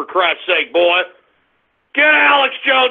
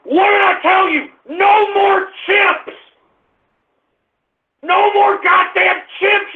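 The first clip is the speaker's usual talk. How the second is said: real furious rant; 6 semitones higher than usual. Real, not acted. Host scolding prank callers who may get him off air, furious shout. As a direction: yell it in outrage, stretching the key words.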